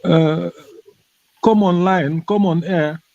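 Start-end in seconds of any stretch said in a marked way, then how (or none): none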